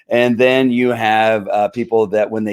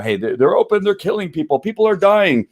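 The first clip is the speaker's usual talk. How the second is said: higher whiny alarmed mock voice; mocking snitch; 8 semitones higher than usual. Host mocks a snitch to viewers, higher whiny alarm. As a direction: make fun of a panicky tattletale.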